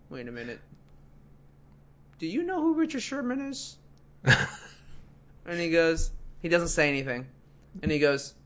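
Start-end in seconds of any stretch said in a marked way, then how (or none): 0.1-3.8 softer then higher, dawning question; dawning realisation. Retold or imitated. Desk guy realises who the player is, quietly amazed.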